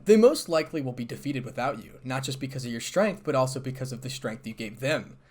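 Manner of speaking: usual speaking voice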